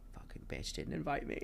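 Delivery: spiteful unvoiced mutter; whispered teenage grudge; jealous whisper. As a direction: hiss it like a bitter teen. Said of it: "was like".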